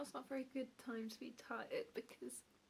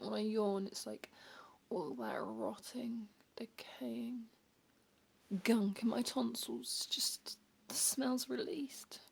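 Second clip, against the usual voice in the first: slow louder whisper; 6 dB louder, slower than usual. Acted. Describing yawn breath, slow.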